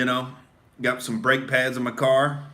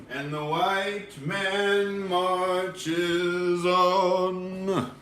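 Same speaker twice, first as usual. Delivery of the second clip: very slow, stretched grand proclamation; mock solemn proclamation; 3 semitones higher, much slower than usual. Host intoning a mock slogan very slowly.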